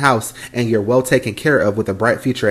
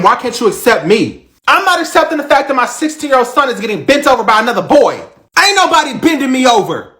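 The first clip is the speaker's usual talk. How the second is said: loud, high, angry back and forth; heated shouting argument; 10 semitones higher, 5 dB louder than usual. Acted. Mother and son shouting over coming out. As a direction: yell it across the kitchen.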